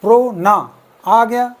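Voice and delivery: प्रो ना pulled apart slowly, ना stretched; slow syllable count of pronunciation. Shown as a demonstration. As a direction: tap out प्रो and ना as separate beats. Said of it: "वावेल का प्रोनाउंसिएशन कितनी बार".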